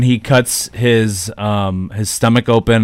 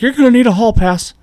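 much higher, prim mock teacher; 11 semitones higher than usual. Teacher telling leaving student, high prim voice. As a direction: chirp it like a strict schoolteacher. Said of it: "is like".

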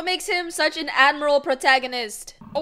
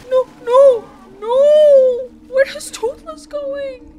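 higher and louder, anguished; alarmed cry; 8 semitones higher, 10 dB louder, slower than usual. Real, not acted. Viewer panicking as Toothless leaves, loud and high.

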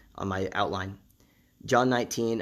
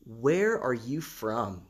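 very slow, each word drawn apart; slow searching question; much slower than usual. Retold or imitated. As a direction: ask it slowly, weighing every word. Pilate questions Jesus, slowly.

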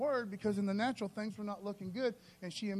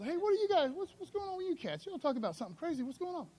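higher, easygoing, chummy greeting; casual Jesus; 9 semitones higher, faster than usual. Retold or imitated. Jesus greeting sad disciples on the road, casual and chummy. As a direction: greet them like an old buddy. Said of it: "you know, Jesus".

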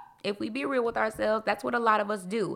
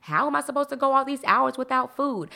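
mock worried protest; 7 semitones higher than usual. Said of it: "those who are afraid of fasting".

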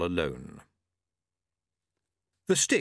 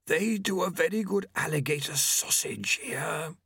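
hissing Famine; 7 semitones higher than usual. A narrator's reading. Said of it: "Famine hissed".

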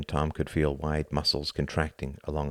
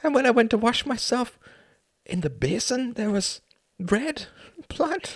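high-pitched, agitated storytelling; distraught confession; 14 semitones higher than usual. Acted. Upset man recounting his night to a friend, distraught.

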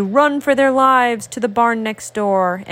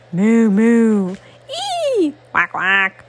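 animal noises; 3 dB louder, slower than usual. Acted. Animals calling goodbye.

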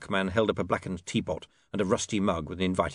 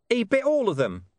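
high, gruff dog voice with a knowing air; a streetwise dog explaining things; 5 dB louder than usual. Acted. Deep Bone the talking dog.

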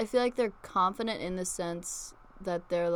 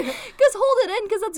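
laughing; 11 semitones higher, 5 dB louder than usual. Real, not acted. Laughing at fart talk.

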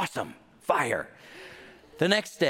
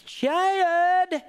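Chad drawn into two syllables, much higher and louder, Southern; 5 dB louder than usual. Retold or imitated. Mom calling her son from downstairs, Southern, high and loud. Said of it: "she's a good Southern lady".

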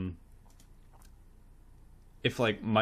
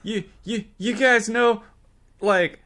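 high, loud, badly faked criminal swagger; putting it on; 12 semitones higher, 11 dB louder, slower than usual. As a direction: overact trying to pass as a crook. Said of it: "he's putting it on a little bit".

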